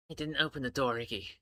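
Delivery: low, slow, heavy voice. Acted. Bucks answering Iggy, low and heavy. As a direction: weigh down each word, low. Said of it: "Bucks says slowly, heavily".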